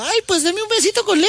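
very high granny falsetto; high-pitched put-on old lady for the punchline; little old granny. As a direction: squeal it like a comic little grandma. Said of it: "llega la abuelita y le dice".

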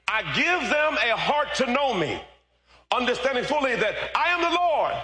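high, ringing shouted scripture; full-force scripture preaching. Real, not acted. Preacher giving God's promise to the church, ringing proclamation.

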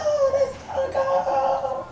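put-on high, drawn-out little girl voice; niece. Niece showing off her uncle at the party, high and drawn out. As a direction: sing it out high and slow like an adoring niece. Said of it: "she was like".

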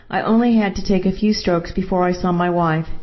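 plain reading voice

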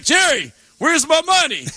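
high-pitched indignant call; play-acted confrontation over missing money; mock angry demand. Acts confronting a manager over money.

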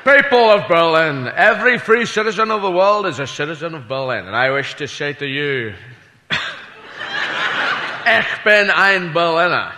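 put-on a Scottish accent orating grandly; lightly voiced Scottish oratory; Connery-Kennedy speech. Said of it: "Sean Connery doing, uh, President Kennedy".

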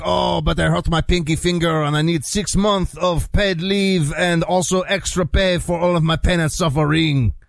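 high, whining mock French complainer; mock French guy whining about his pinky; whiny Frenchman. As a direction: ham up a sniveling French cop. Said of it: "like a guy named Francois is like".

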